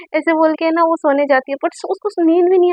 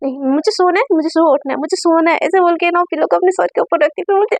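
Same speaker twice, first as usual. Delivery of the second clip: ordinary delivery, nothing marked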